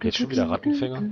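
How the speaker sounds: a startled, high, fast question; surprised question. Real, not acted. Player hearing the humming start again, startled and high. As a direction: caught off guard, jump up in pitch.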